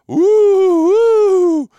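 high, loud, drawn-out ghost hoot; a playful spooky woo to startle listeners. Pretend. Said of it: "That's me trying to be spooky".

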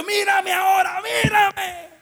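high, loud angry yell, re-enacted; furious shout. Preacher confronts the possessed woman, furious shouting. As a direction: build to a screamed command. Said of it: "Me dio un coraje".

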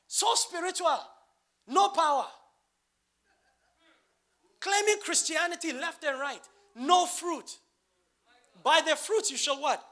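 loud, high, driving sermon cadence; full-force pulpit delivery, punching each short phrase; fiery preaching. Acted. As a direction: preach it hard to the congregation.

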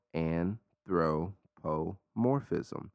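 "Anthropomorphism" drawn way out, syllable by syllable; super slow. Shown as a demonstration. Podcaster leading listeners through a long word, super slow. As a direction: say "Anthropomorphism" super slowly, a pause between parts. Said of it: "super slow".

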